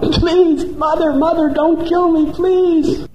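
high, frightened begging; terrified pleading. Acted. Dacoit leader begging the goddess to spare him, terrified. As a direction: plead for your life in terror.

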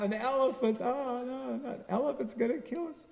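high teasing mock alarm; mocking laugh. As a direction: pretend to be scared, laughing. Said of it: "they laugh".

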